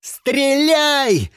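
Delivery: high, drawn-out shout; yelling. A narrator's reading. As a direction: yell a desperate command. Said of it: "заорал он". (отчаянный крик)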